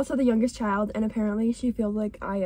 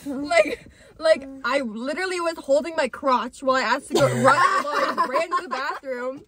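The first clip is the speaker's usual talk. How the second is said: laughing storytelling; 3 semitones higher than usual. Real, not acted. Laughing through embarrassing pants story.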